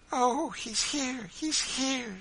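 high, slow, drawn-out cry; exclaiming. A narrator's reading. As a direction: cry out high for the child you feared lost. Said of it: "she exclaimed".

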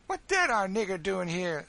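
high, loud character voice; loud, lively taunting question; boisterous Sambo. A narrator's reading. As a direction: call it out high and brash. Sambo accosts a newcomer.

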